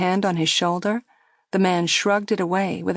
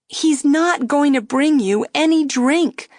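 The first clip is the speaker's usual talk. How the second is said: impatient; 8 semitones higher, 5 dB louder than usual. A narrator's reading. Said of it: "the girl said impatiently".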